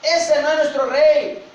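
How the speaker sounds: high, loud, drawn-out rejection; slow, emphatic roar of refusal; enraged mob shouting. Acted. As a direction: shout it like an inflamed crowd. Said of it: "la turba enardecida gritaba".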